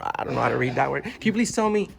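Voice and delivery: ordinary delivery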